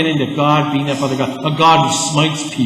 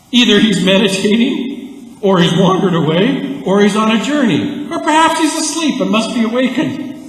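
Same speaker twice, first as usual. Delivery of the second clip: sing-song teasing list of excuses; mock the prophets with a singsong taunt; 6 semitones higher, 3 dB louder than usual. Preacher voicing Elijah mocking the prophets of Baal, singsong taunt.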